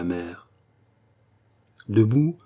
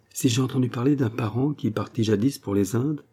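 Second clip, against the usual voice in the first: usual speaking voice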